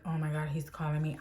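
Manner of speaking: a hushed, worried gasp at the ringing phone; quiet panic. Retold or imitated. Telling how she panicked when her boss called, hushed panic. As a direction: drop your voice low in dread.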